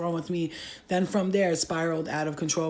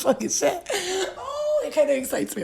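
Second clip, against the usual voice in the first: laughing; 12 semitones higher than usual. Real, not acted. Laughing at own story.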